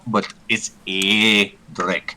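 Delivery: "Edric" drawn out very slowly, ED-ric; slow correct pronunciation. Shown as a demonstration. Player teases the DM while saying "Edric" slowly. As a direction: stretch out "Edric" so the DM hears it. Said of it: "Lindsay, I love ya".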